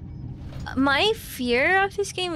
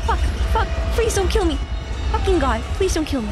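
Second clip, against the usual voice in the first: frantic begging, louder; scared pleading; 6 semitones higher, 4 dB louder, faster than usual. Real, not acted. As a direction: plead for your life. Pleading during chase scene.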